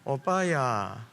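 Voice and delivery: put-on very slow, coaxing Gyeongsang girl's voice; 애교 있는 경상도 사투리; sweet Gyeongsang dialect. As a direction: draw it out tenderly in Gyeongsang dialect. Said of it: "안 그런 경상도 사람들도 있습니다".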